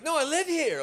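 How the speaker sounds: high-pitched mock woman's voice; hammed-up high, eager reply; excitable American woman. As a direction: imitate her, pitched right up. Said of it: "She's like".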